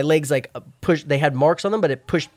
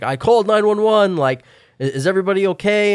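concerned stranger; 6 semitones higher, 4 dB louder than usual. Retold or imitated. Stranger emerging from woods.

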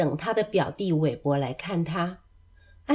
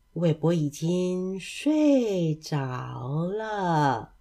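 very slow, words stretched out; drowsy drawn-out ending; 3 semitones lower, 3 dB quieter, much slower than usual. A narrator's reading. Narrator closing as cousin sleeps, drowsily drawn-out. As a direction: let the last words drift into sleep. Story ends with falling asleep.

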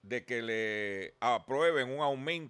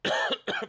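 choking cough; 5 dB louder than usual. Real, not acted. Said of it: "perdón, me ahogué".

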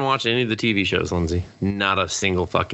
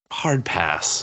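normal talking voice

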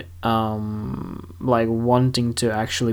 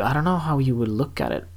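normal talking voice

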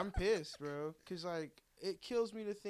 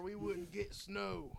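mock slow rant; 4 semitones higher, much slower than usual. Mocking an Obama-blaming rant.

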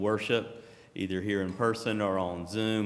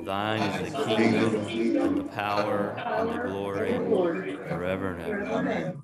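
unison prayer recitation; reciting a prayer. Acted. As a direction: pray it aloud with the congregation. Lord's Prayer doxology.

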